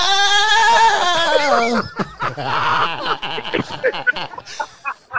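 high sung auto-tune wail; auto-tuned wail. Pretend. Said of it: "Auto-tune, watch this".

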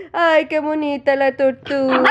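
high, lilting pet praise; cooing. Real, not acted. Owner praises tortoise as dog barks, cooing.

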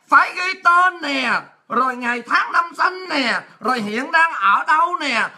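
slow item-by-item list, drawn-out endings; exasperated instructing. Retold or imitated. Officer correcting Lisa's statement, slow exasperated instructing. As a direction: list each item slowly as if to a dim pupil.

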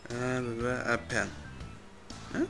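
ordinary talk, nothing marked

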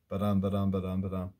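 each "ba-dum" weak then strong, evenly chanted; iambic beat. Shown as a demonstration. Teacher showing the expected iambs, "ba-dum" chanted in even rising stress. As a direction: tap out "ba-dum" in a steady run, stress on "dum". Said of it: "we're expecting an iamb".

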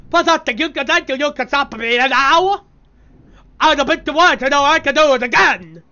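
a mild mock row between two parents; quarrelling game characters. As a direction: ham up a bickering cartoon argument.

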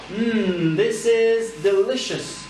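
Hmm gourmand puis this is delicious lent et aigu; délice joué. Pretend. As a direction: savoure comme une bouchée exquise, plus aigu. Savoure un plat pour l'exemple. (régal exagéré pour l'élève)

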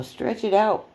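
ordinary talk, nothing marked

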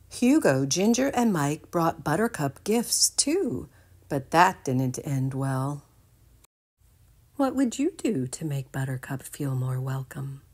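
ordinary delivery

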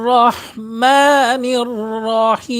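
রহমানির রহিম chanted slowly with a full, heavy র. Shown as a demonstration. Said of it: "রয়ের উচ্চারণটা মোটা হবে".

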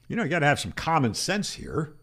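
normal talking voice

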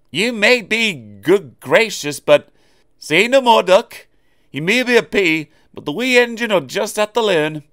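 a stern Scottish engine setting him straight; Scottish engine scolding the boastful newcomer. Acted.

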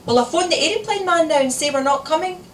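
high, loud, exasperated question; threatening mother. A narrator's reading. Mother threatening to cancel the holiday, cross and loud. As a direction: warn them with a loud rhetorical question.

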